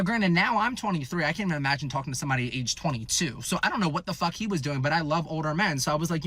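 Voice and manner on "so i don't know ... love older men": normal talking voice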